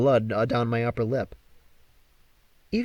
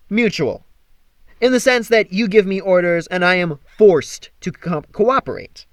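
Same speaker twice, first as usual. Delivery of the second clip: indignant; 4 semitones higher, 6 dB louder than usual. Acted. Apollo indignant retort.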